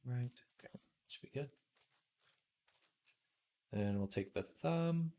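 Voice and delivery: ordinary delivery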